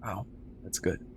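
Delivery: ordinary talk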